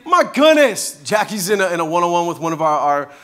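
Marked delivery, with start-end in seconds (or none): 0.0-1.0 slow, loud, higher exasperated shout; father shouting up at daughter, louder and higher; irritated. Retold or imitated. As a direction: scold the kid in the tree. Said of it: "honestly, I'm irritated".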